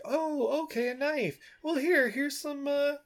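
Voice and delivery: high put-on indulgent mom; mock mother handing over coins.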